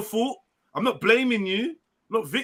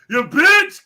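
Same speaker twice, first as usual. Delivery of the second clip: teasing shout; 10 semitones higher, 10 dB louder than usual. Acted. Teasing friend on camera.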